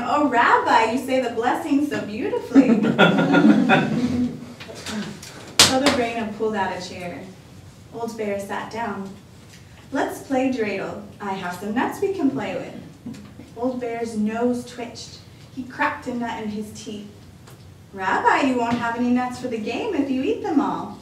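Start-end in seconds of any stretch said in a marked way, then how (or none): 0.0-2.8 put-on higher, louder kindly old woman; lightly voiced cheerful grandma welcoming a guest; a hint of a bright old lady; old grandmother voice. Baba Brana's voice. 2.8-5.6 put-on airy old woman's laugh; a breathy, pleased chuckle. 9.9-21.0 lightly voiced cheerful grandma welcoming a guest; a hint of a bright old lady; old grandmother voice. Baba Brana's voice.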